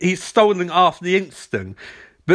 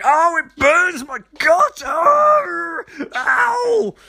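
high, slow, crying mock agony; hammed-up pain cry; 12 semitones higher, much slower than usual. Hero crying out after being shot, hammed up. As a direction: wail in over-the-top pain. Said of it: "Flash is like".

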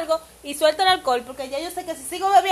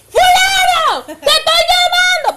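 put-on high, loud drunken yell; drunk shouting; 12 semitones higher, 7 dB louder, much slower than usual. Mimicking a drunk who shouts at a friend standing beside them, loud and high. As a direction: bellow a name across the room, slowly. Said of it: "comienza a decirte".

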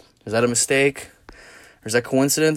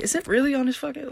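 surprised disbelief; 4 dB quieter than usual. Retold or imitated. Disbelief at fly on TV.

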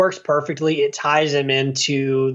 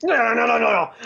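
high frantic no no no; high mock protest; 7 semitones higher, faster than usual. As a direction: squeal it like a hammy hero. Voicing hero sliding across.

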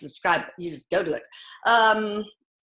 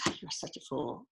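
soft, low, fondly amused murmur; a touch of affectionate scolding; fond teasing; 7 semitones lower, 14 dB quieter than usual. Retold or imitated. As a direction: hush it like a sister charmed by a silly kid. Sister smiling at jumping son.

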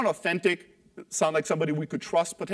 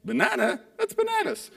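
mock surprise; 6 semitones higher than usual. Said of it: "the brain went".

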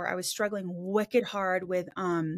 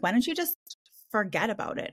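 mock Valley Girl, pitched up then dropping. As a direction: put on a breezy Valley Girl lilt. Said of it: "with their freaking Valley Girl voice".

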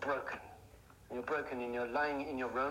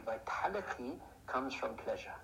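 ordinary delivery

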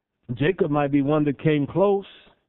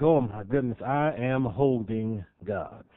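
hushed wonder; 5 semitones lower, 5 dB quieter, slower than usual. Pretend. Mary marvelling at baby Jesus.

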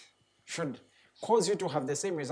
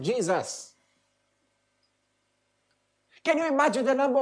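louder, building preacher's cry; impassioned preaching; 5 semitones higher, 4 dB louder than usual. Real, not acted. Preacher urging his congregation, rising and impassioned. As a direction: preach it up to full force.

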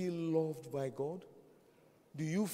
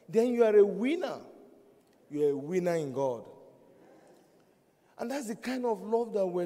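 raised, louder pulpit preaching; preaching at full force; 4 semitones higher, 5 dB louder than usual. Acted. Pastor urging his congregation about true love, preaching at full force. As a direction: drive it home to the congregation.

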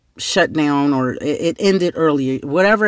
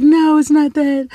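high, loud distraught wail; acted tearful protest, pitched way up; mock bawling; 11 semitones higher, 5 dB louder than usual. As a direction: imitate a friend wailing through tears. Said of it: "she's just bawling and bawling".

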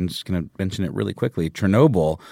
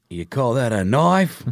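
put-on slow Australian accent; Crocodile Dundee; 3 semitones higher, slower than usual. Quoting the Australian movie hero to co-host, slow broad Aussie accent. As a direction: put on a drawn-out, over-the-top Aussie accent. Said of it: "That Crocodile Dundee guy".

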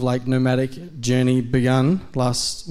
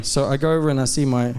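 usual speaking voice

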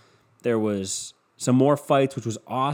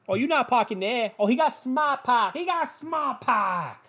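put-on Boston accent; 11 semitones higher, slower than usual. Mimicking the Hyundai commercial, hammed-up Boston accent. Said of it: "they're fucking talking in that Boston accent".

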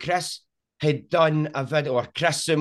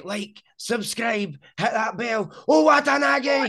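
hyped-up shout; 8 semitones higher, slower than usual. Retold or imitated. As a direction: hype the crowd like a showman, then roar the name. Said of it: "screaming down the microphone and shouting".

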